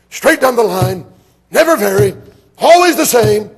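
high, loud, hammering revival preacher; preacher rising to full shouting fervor; fiery old-time preaching. Retold or imitated. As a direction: shout it like a hellfire preacher from the pulpit.

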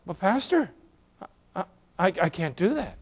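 put-on hushed, frail old widow protesting; timid old lady. Widow refusing her pastor, small hushed voice. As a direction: shrink back like a shy elderly lady.

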